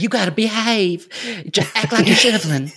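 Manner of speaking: playful mock Southern accent voice; [name]'s Southern accent. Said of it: "I tried putting on an accent".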